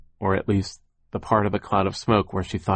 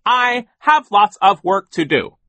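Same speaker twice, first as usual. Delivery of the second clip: slow, loud, high declaration; emphatic, dismissive boss; barking; 12 semitones higher, 9 dB louder, slower than usual. A narrator's reading. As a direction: bark it with self-importance. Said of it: "Sir barked".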